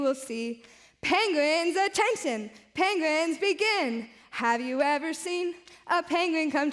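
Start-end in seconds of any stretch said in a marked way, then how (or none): none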